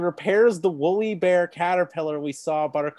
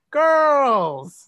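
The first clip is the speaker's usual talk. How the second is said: loud, high drawn-out greeting; calling out; 10 semitones higher, 9 dB louder than usual. Acted. Father opening the door to greet his daughters, loud happy call. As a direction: call out big to your daughters.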